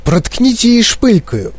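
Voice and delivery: high-pitched exclamation; urgent calling out. A narrator's reading. Someone's father suggests a pin, urgent calling out. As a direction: shout out a suggestion.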